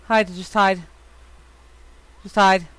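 ordinary talk, nothing marked

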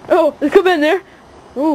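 normal talking voice

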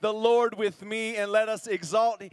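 loud, high, slow praise call; preaching at full force. Acted. Pastor calling the congregation to praise, full-force preaching. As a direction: rouse the church to praise the Lord.

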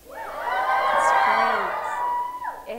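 sudden high, loud burst of enthusiasm; a gleeful cheer of praise; excited shout. Real, not acted. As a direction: shout it out with glee. Excited praise of Easter pageant.

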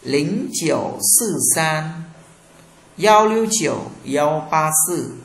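"giao lưu chiều" read high and loud, the rest slow; digits in Chinese. Shown as a demonstration. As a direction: read the phone number out slowly, digit by digit. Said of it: "số điện thoại của tôi là". (đọc chậm từng số điện thoại bằng tiếng Trung)

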